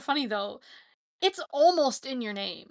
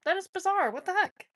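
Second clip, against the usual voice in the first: surprised; 7 semitones higher than usual. Real, not acted. Baffled by comments appearing.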